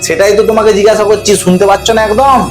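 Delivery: ordinary delivery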